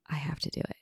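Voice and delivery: breathy whispered aside; whispering. Real, not acted. Apologizing for clearing throat.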